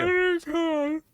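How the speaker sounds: high, loud, drawn-out protest; insistent. Real, not acted. Insisting she tears up.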